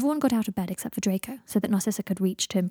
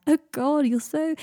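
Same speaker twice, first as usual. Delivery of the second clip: laughing; 8 semitones higher, 4 dB louder, slower than usual. Acted. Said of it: "wiping at his eyes".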